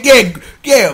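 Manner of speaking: ordinary talk, nothing marked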